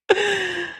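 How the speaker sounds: high-pitched giggling fit; high laugh. Real, not acted. Cracking up at own impression.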